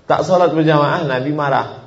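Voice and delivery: ordinary talk, nothing marked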